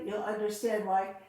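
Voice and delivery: ordinary talk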